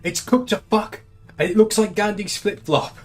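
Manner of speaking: high, mocking put-on voice; mocking quote voice. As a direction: sneer it out as a high mock critic. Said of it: "quotes like".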